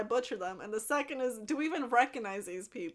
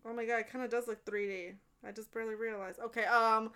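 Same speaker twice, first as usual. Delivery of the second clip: normal talking voice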